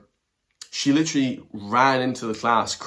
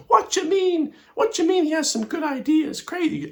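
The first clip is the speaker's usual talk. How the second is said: shrill, much higher, louder caricature shriek; mock exploding lecturer; 3 dB louder than usual. Vlogger mimicking lecturer exploding at his praise of Trump, shrill mock outrage. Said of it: "She just explodes".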